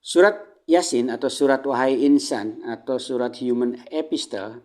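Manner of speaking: normal talking voice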